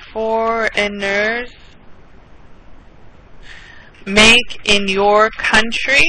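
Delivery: very slow teacher repeat; slow and clear. Shown as a demonstration. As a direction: spell the question out slowly. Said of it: "(repeats the question slowly)".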